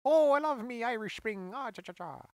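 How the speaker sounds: mock Irish soap-ad voice; Irish accent. Imitating an old soap commercial, a hammed-up Irish accent. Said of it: "Well, the Irish accent".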